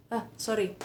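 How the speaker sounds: ordinary delivery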